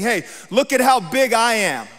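lightly voiced braggart showing off his size; boasting enemy. Enemy boasting in preacher's retelling.

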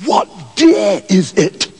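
ordinary delivery

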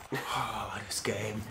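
whispered, to himself; hushed aside about the game. Real, not acted. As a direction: mutter it under your breath. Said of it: "aside about the video game".

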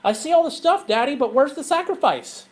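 high, lightly voiced little boy; a boy asking his daddy innocently; child's voice. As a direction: ask it like an innocent young son. And his son says to him.

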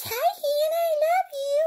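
very high little plush kitty voice, cooing; toy cat voice. Acted. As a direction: speak as a tiny stuffed cat for Hannah. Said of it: "She says".

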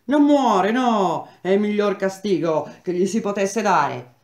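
loud, high, furious retort; angry shout. Acted. Attacker snarling back at Parigi during the beating, loud and angry. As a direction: spit it back at her with rage.

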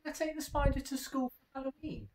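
high, fast, pleading kitten; eager plea. A narrator's reading. Splat begging his mum to take the spider. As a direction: beg excitedly, racing through it.

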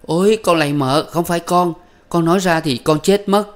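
high, loud cowering plea; terrified pleading. A narrator's reading. Cowering servant girl begging not to be hit, high terrified plea. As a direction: beg for mercy in terror. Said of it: "nằm co rúm người".

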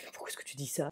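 hushed, scolding question; whispering a rebuke to her joking husband; embarrassed whisper. Retold or imitated. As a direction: whisper it so the kids won't hear. Embarrassed whisper about joke.

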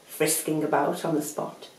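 ordinary delivery

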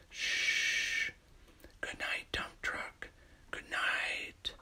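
soft bedtime whisper, almost no voice. Acted. Reader whispering goodnight to Crane Truck at bedtime, whispered. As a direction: hush it like a child is asleep.

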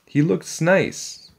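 high, slow, dreamy admiration; admiring little sister. A narrator's reading. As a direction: coo it high and drawn out. Annie admires the dinosaur.

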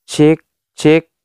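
mic test with echo effect. Shown as a demonstration. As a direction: test words ringing with reverb. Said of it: "echo-nya itu sudah mulai keluar".

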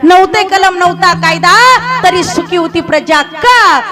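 rhythmic refrain, pitched high and loud at the start of each phrase; rally the crowd with a slogan; fervent kirtan oratory; rousing preaching. Acted.

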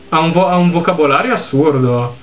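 ordinary talk, nothing marked

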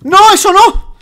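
very high, loud shriek; panicked shriek. Real, not acted. Gamer screams as his friend nears the lava. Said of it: "deja de chillar".

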